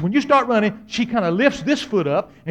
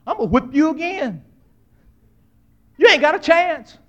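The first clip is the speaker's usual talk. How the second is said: higher, raised voice of a threatening mother; mama warning her crying child; scolding mama; 6 semitones higher than usual. Retold or imitated. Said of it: "She said".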